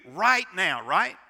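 loud, high mocking "Right now, right?"; preacher mocks instant answers; mock question. Shown as a demonstration. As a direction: tease the congregation's impatience.

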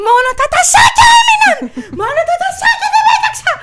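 very high, loud, laughing-crying shriek; hysterical melodrama shout. Pretend. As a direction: scream it like a betrayed wife in a stage drama. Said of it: "Και άρχισα". (υστερική θεατρική κραυγή)

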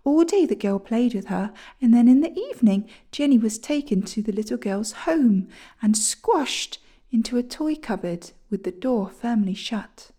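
plain reading voice

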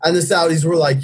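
ordinary delivery

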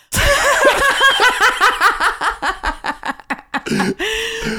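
loud high cackling laugh; can't stop laughing out loud; burst of laughter. Real, not acted. Hearing the Monkey Brain nickname.

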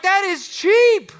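mock outraged complaint. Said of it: "you're like".

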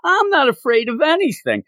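high, hammed-up indignant protest; indignant denial. Thor bragging to Jane, mock indignant. Retold Thor insisting he fears nothing.

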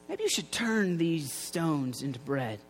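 slow, low, lightly voiced tempter; Satan tempting. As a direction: dangle the suggestion slowly and softly. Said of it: "Satan comes up to him".

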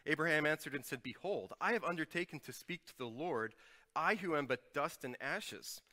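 measured public Bible reading; solemn scripture reading. Acted. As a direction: read the passage to the congregation.

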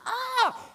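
high scream; child scream. Retold or imitated. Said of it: "then she'll scream".

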